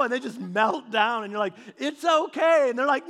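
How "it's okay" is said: raised, loud calming over the noise; calm the child down above the wailing; soothing parent. Retold or imitated. Parent soothing a crying kid.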